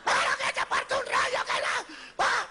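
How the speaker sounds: very high, strained mock shriek; hammed-up screeched curse from the gut; yelling from the stomach. Husband after the wedding cursing his wife, yelling from the stomach. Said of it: "sino del estómago".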